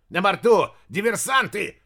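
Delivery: furious shout; angry outburst. Acted. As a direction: explode at them. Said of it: "взорвался толстяк".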